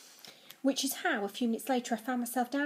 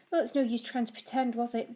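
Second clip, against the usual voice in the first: usual speaking voice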